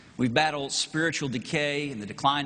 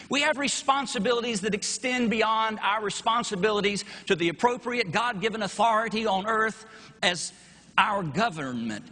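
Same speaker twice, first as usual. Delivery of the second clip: rising sermon; 6 semitones higher than usual. Acted. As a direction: preach it home, climbing with each line. Preacher builds to full force.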